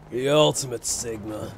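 ordinary delivery

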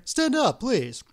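high, loud exclamation; urgent order. A narrator's reading. Engineer to the kneeling priest, urgent cry. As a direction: order him up, then ease into a plea.

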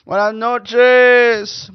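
high, loud, very slow Spanish greeting. Acted. Greeting listeners in mock Spanish, drawn out. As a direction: roll it out like a TV host.

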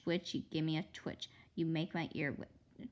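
ordinary delivery, nothing marked

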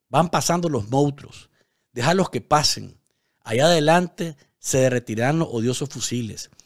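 heartfelt, emphatic poem recital with long falling phrases; poem reading. Acted. News host reads a viewer's poem to Nicaragua, heartfelt recital. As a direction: read it like a love letter to a homeland.

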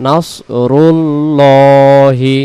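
নাসরুল্লাহি with the lam said full and round, lips rounded; drawn-out নাসরুল্লাহি, lam heavy as 'lo'. Shown as a demonstration. Teacher showing the heavy lam of Allah, slow and drawn-out. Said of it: "বলতে হয়".

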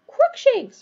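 high, loud wail of dismay; dismayed moan. A narrator's reading. Hermione spots her approaching cat, wailing in dismay. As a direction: cry the cat's name in dread. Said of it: "Hermione moaned".

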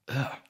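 short disgusted grunt, high and loud; disgusted ugh. Real, not acted. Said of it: "reacts to the slimy son".

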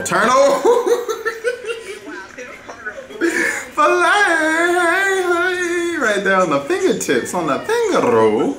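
high squeal of laughter running through the call; excited laughing. Real, not acted. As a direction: crack up and squeal, then come down gradually. Cracking up at a highlight.

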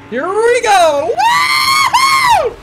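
high, loud thrilled cheer; excited whoop. Real, not acted. Gamer cheering as he launches the car. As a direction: let it rip as the car takes off.

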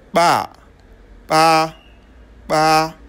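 "ba" voiced and glottal, made with both lips; bilabial implosive. Shown as a demonstration. Teacher giving examples to learners, "ba" as a bilabial implosive. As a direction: repeat implosive "ba" separately, lips coming together. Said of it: "Elle est biliale, sonnant et glottale".

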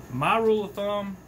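ordinary delivery

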